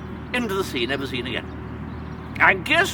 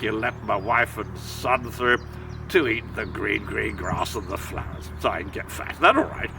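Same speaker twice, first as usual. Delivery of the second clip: put-on much lower, genteel old-chap voice; posh big billy goat. Big Billy Goat Gruff to the troll, low posh voice. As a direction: sound like a pompous old gentleman.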